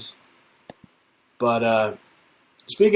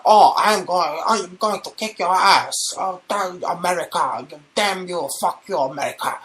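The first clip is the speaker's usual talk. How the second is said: higher put-on tough-kid voice; mocking schoolyard chump; 5 semitones higher than usual. North Korea as a school chump threatening America, higher mock voice. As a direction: play the weak kid trying to act tough, higher. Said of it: "he's just like".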